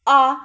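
а called out loud; loud teacher's model vowel; isolated vowel а. Shown as a demonstration. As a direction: sing out the lone а. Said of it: "Ми чуємо м".

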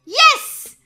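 high, loud burst of victory; triumphant shout. Real, not acted. Player succeeding on hard mode, triumphant shout. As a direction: yell it out in triumph.

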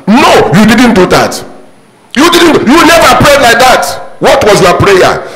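scolding rebuke. Real, not acted. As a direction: cut them off, you never did that. Preacher rebukes congregation.

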